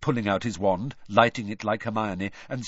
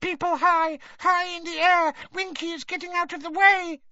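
very high, squeaky elf voice; tiny house elf squeaking in panic. A narrator's reading. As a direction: squeak it out, frightened and distracted. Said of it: "she squeaked distractedly".

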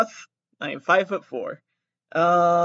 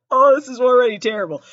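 louder and higher, mock despair; exasperated laugh; 7 semitones higher, 7 dB louder than usual. Real, not acted. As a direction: cry out in embarrassment. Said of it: "repeated twice".

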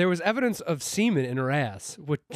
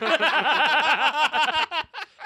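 high, loud burst of laughter; big high-pitched cackling laugh; 11 semitones higher, 5 dB louder than usual. Real, not acted. Loud laughter at courtroom bit.

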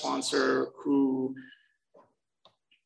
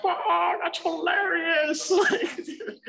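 much higher, slow and amused; much slower than usual. Retold or imitated. A newcomer reacting to the speaker's joke, high and slow with amusement. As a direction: savour the joke, delighted.

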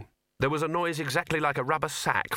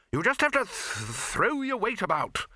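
exasperated instruction; 9 semitones higher than usual. A narrator's reading. Arch-Chancellor instructing the bursar mid-flight, high exasperated call. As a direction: urge him on, stumbling mid-word.

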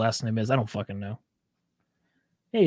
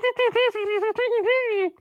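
very high muffled squeaky chatter; squeaky muffled cartoon mumbling; muffled Kenny gibberish. Shown as a demonstration. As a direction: mumble high gibberish as if through a covered mouth. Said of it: "he just puts his hand over his mouth".

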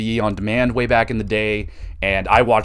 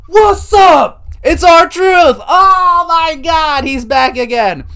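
very high, loud hype shout; R-Truth entrance shout; 8 dB louder, much slower than usual. Retold or imitated. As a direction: belt it out like a wrestler's entrance.

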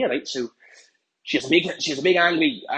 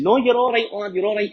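high, loud, breezy reassurance; boisterous drinking-buddy persuasion; egging a mate on; 8 semitones higher, 5 dB louder than usual. Retold or imitated. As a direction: wave away his worries, voice pitched up.

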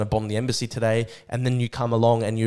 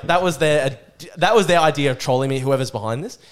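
ordinary talk, nothing marked

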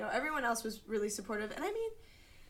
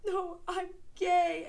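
slow, higher, sobbing through the words; crying confession; 9 semitones higher, 4 dB louder, slower than usual. Retold or imitated. Teen coming out to her friend, crying. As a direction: choke it out through tears. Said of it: "But I was crying".

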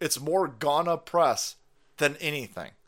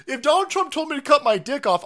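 normal talking voice

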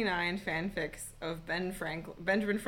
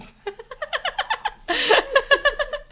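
high loud laugh; 7 dB louder than usual. Real, not acted. Laughing at own self-pity.

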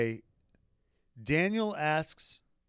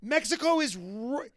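high, loud incredulous outburst; exasperated inner rant; 12 semitones higher, 7 dB louder than usual. Retold or imitated. Host venting at Daniel's dumb question, high and loud. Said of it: "I'm thinking to myself".

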